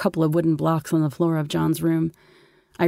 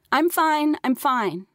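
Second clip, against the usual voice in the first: mock shout; 9 semitones higher, slower than usual. A narrator's reading. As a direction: call out reassurance from a distance. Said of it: "she shouted".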